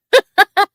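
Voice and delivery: high, loud happy laugh; a real delighted laugh out loud; burst of laughter. Real, not acted. Thanking Christopher for two dollars, bursting into a happy laugh.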